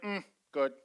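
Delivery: flat, low unconvinced "Mm, good.". Retold or imitated. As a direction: say "good" like it's not great. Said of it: "depends on the tone of your voice".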